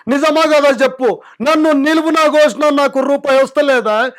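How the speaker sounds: a chief minister pleading his case to the crowd, loud and high; Revanth Reddy impression. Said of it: "Telangana CM Revanth Reddy గారి voice".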